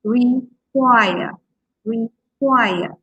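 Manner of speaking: re-QUIRE, with QUIRE stretched and strong; stress on last syllable. Shown as a demonstration. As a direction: draw out and punch QUIRE in require. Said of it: "you can stress the last part".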